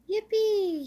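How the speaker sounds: high, loud cry of delight; joyful shout. Acted. Little Squirrel rides Daddy's shoulders through woods, whooping loud. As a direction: whoop with joy on the ride. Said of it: "he shouted".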